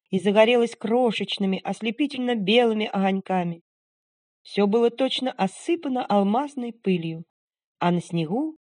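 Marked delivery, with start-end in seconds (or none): none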